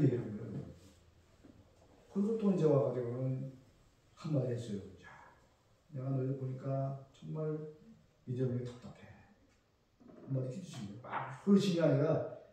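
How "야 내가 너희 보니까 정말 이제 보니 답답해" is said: softer, gentle rebuke; a loving teacher gently chiding. Pretend. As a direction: scold tenderly, no shouting. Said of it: "사랑의 어조로".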